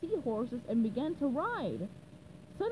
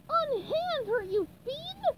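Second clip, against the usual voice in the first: yelling; 13 semitones higher, slower than usual. Acted. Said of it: "he yelled".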